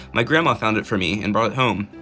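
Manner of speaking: ordinary talk, nothing marked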